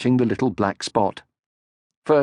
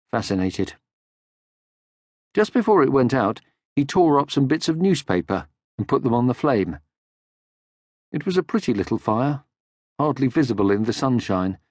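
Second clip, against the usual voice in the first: usual speaking voice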